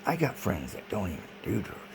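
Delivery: ordinary talk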